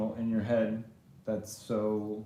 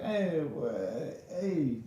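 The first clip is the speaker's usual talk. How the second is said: higher-pitched New Yorker "hey"; lightly put-on classic New York voice; New York accent; 4 semitones higher, slower than usual. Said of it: "He's a classic New Yorker".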